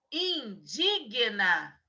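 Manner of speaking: Indigna drawn out slowly, syllable by syllable; slow dictation of the wrong word. Shown as a demonstration. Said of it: "Anota aí o co-, o, o errado".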